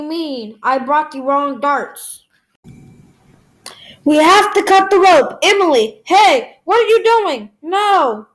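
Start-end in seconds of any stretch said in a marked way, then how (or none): 4.0-8.3 loud alarmed shout; frantic loud pleading; urgent shouting. Acted. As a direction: yell in panic to be heard. Urgent crisis, cutting the rope.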